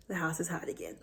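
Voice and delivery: hushed unvoiced whisper. Real, not acted. Mom whispering to viewers about her sneezing kid, hushed. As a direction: whisper it so the house stays quiet.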